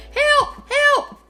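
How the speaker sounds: very high, loud panicked cries; cries for help. A narrator's reading. Tyrone crying for help as bees chase him. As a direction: scream for help, terrified. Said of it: "heard his cries".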